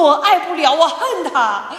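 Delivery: half-laughing anguish; anguished cry. Retold or imitated. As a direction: cry out to God you can't love him. Crying prayer on her knees.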